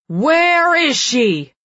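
long, high, loud enraged yell; terrible scream. A narrator's reading. Furious brother screaming down the stairs for his sister, drawn-out scream. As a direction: bellow it slowly from far up the stairs. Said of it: "a terrible voice screams".

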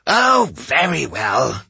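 slow, resigned sigh. A narrator's reading. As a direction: give in with a sigh. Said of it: "she sighed".

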